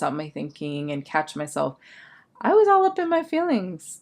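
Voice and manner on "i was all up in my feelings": jumps high, self-mocking; mock-dramatic high voice for the punchline. Real, not acted. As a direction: squeal it out at yourself. Punchline after self-analysis.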